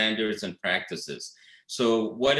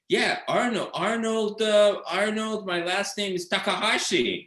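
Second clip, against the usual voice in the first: Pat's made-up backstory; 10 semitones higher than usual. Retold or imitated. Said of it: "he starts making up his story".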